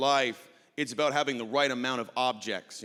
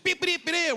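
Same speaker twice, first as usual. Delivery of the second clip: high chirpy beeping nonsense; mock gibberish beeps; 4 dB louder than usual. Comic mimicking East Coast friend's speech as high beeps. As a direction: beep it out high as an unclear accent. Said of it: "whatever the heck he said".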